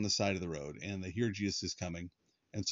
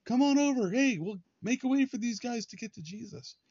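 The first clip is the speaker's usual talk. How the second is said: much higher, louder at the start, cheery invitation; welcoming crowd; 13 semitones higher, 3 dB louder than usual. Pretend. Crowd inviting the blind men over, high cheerful welcome. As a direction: play the crowd making room, bright and welcoming. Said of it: "They don't say".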